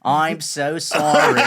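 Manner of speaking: slow, laughing, loud catchphrase. Pretend. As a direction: drag out "I'm so sorry" while laughing. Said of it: "without you saying".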